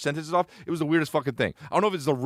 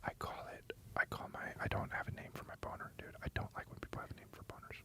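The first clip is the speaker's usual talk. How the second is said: barely audible whisper; faint, hushed ASMR question; 18 dB quieter than usual. Pretend. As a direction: whisper very softly into the mic. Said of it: "Can you do some A S M R".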